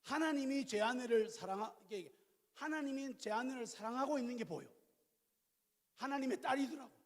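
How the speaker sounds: ordinary talk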